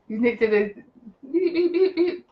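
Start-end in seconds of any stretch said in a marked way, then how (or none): 1.3-2.3 high sung beeps covering a word; playful high-pitched beep-beep; mock censor bleep.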